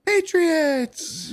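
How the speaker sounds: put-on high, bright-pitched child's cry of a team name; a little girl calling out her pick. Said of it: "and then she just goes".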